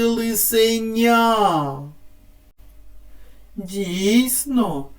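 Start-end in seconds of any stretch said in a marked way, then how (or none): 0.0-1.8 "лисеня" said slowly, final ня held long; stress on third syllable. Shown as a demonstration. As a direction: stretch the last syllable of "лисеня". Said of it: "на третій".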